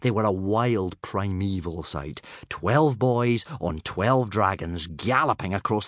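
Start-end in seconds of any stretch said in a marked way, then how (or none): none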